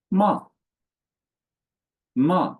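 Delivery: "Ma" high, loud and sharply falling; "Ma" short, with a brisk fall; fourth tone. Shown as a demonstration. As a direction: drop "Ma" abruptly from a high start. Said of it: "sharp and falling".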